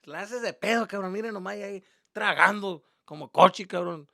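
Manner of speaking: high, loud gloating dad imitation; mocking dad. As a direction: crow in Spanish at a caught son. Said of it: "He's just like".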